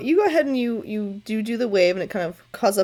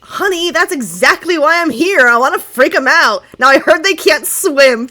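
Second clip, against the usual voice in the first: loud, higher, teasing mermaid voice; sassy mermaid; 6 semitones higher, 8 dB louder than usual. Acted. A merfolk taunts the twitchy adventurers in a sassy voice. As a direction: taunt the scared adventurers sweetly. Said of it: "You hear".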